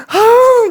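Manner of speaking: a sudden loud high groan of frustration; exasperated cry. Real, not acted. Striking a damp match and failing, loud groan.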